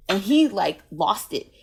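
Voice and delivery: usual speaking voice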